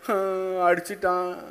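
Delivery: lightly voiced tearful schoolchild; quiet mock whimper of a hurt child. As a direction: imitate a child complaining after a beating. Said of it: "ம்னு சொல்லிட்டு வந்தாங்கன்னா".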